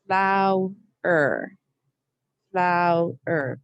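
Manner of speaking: Flower said as flow-er, two clear beats; two distinct syllables. Shown as a demonstration. Teacher models two syllables in Flower. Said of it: "say it as two syllables".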